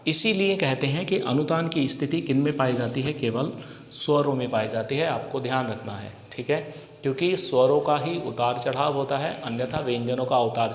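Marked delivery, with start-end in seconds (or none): none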